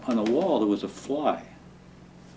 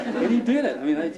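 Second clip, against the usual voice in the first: laughing; 8 semitones higher, faster than usual. Real, not acted. Still laughing at the fly story.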